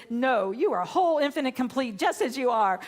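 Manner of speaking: retold angry rebuttal. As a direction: re-enact standing up to a heckler. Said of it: "Somehow I got so mad".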